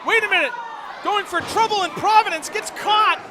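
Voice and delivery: rising excited play-by-play call. Real, not acted. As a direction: call the big move as it happens. Excited commentary on a big move.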